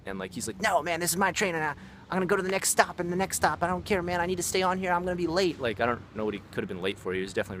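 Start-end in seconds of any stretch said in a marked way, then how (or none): 0.6-5.6 high, strained mock protest; imitating angry ranter. Old passenger refusing to leave the train, mock-angry. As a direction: imitate an agitated old man refusing to budge, pitched way up. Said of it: "screaming and shouting. He was just, like, angry".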